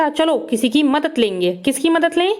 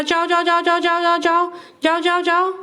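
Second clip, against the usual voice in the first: shooing the cow; 5 semitones higher than usual. Pretend. Shooing cow home, pitch up.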